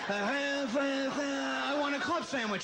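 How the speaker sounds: grandpa tuning in. Retold or imitated. Grandson shaking rabbit ears round grandpa's head, garbled drawn-out imitation. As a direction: stretch it slowly like a badly received broadcast coming in. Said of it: "shake it around his head".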